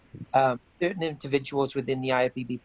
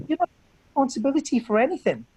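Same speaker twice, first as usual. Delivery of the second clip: higher, louder and faster, reliving the row; retold outburst; 10 semitones higher, 4 dB louder, faster than usual. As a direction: spit it out in frustration as he retells it. Retold heated argument with William.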